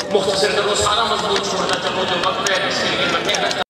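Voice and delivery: loud, impassioned grieving recitation; full-force majlis wail. Acted. Reciter wailing the mourning verses at full force to the mourners. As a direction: cry out the elegy to the mourners.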